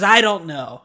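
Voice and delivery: loud, indignant mock retort; hostile customer. Customer asked if it was pump eighteen, hostile. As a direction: push it out loudly, offended to be asked. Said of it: "She is hostile with me".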